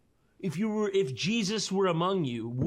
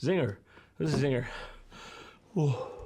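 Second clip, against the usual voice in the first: ordinary talk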